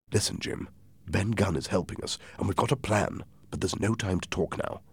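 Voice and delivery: soft, secretive aside; hushed confiding. Acted. Doctor confiding a plan to Jim, hushed. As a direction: keep the volume down throughout.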